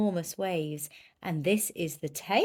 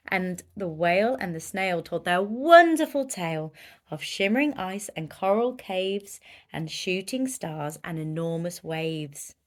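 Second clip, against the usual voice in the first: ordinary delivery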